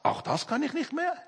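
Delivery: imitated frustrated disbelief; acted frustration. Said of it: "das muss doch die größte Frustration sein".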